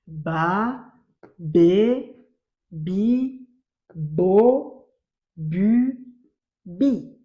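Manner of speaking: "Ba, be, bi, bo, bu" loud, each drawn out and rising; syllable drill. Shown as a demonstration. Teacher reads out syllables for the class, "Ba, be, bi" each separately stretched. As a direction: sound out "Ba, be, bi, bo, bu, bi" one at a time, forcefully.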